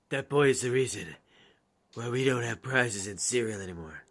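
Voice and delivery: low, raspy gruff character voice. Acted. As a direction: growl it out gruffly. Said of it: "That might be Dan".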